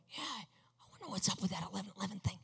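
soft breathy whisper, musing to himself; whispered thought. Retold or imitated. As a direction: whisper the passing thought under the music. A sudden thought while driving.

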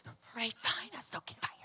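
soft, breath-only grumble; grudging surrender. Retold or imitated. Giving in to God's repeated order, grudging. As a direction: give in under your breath.

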